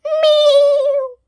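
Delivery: high, loud wailing meow; loud cat cry. A narrator's reading. Wilbur the cat calling to Winnie, loud meow. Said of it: "he cried".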